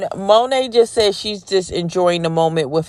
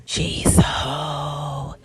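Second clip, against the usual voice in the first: slow hushed whisper, stress on hoe; mock gossip whisper; 3 semitones lower, 6 dB quieter, much slower than usual. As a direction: gasp it out like a scandalized courtroom gossip. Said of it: "clutching their pearls and whispering".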